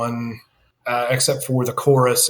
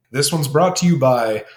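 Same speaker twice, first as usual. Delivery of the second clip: higher-pitched announcer for a joke film ad; mock sponsor read; 5 semitones higher than usual. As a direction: read it like a radio sponsor plug, pitch lifted. Mock sponsor read before the break.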